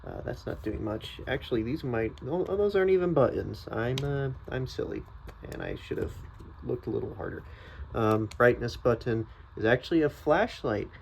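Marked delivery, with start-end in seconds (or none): none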